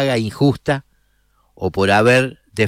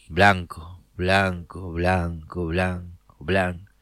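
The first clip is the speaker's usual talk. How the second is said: low murmur, sinking softer; hushed repetition; 7 semitones lower, 7 dB quieter, slower than usual. A narrator's reading. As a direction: repeat it under your breath, fading as it goes. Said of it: "siempre en silencio, continuó". (repetición en voz baja que se va apagando)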